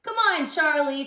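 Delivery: pleading. A narrator's reading. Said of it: "Tommy pleaded".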